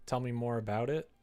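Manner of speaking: usual speaking voice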